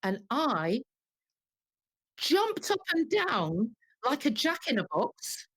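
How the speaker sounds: usual speaking voice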